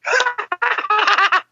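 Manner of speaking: sudden high-pitched burst of laughter. Real, not acted. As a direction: let a squeaky laugh escape. Laughing at the joke.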